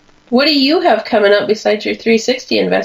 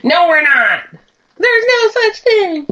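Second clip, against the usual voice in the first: Rachel impression; 11 semitones higher than usual. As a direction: fire it back, offended. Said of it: "Friends reply, quoted".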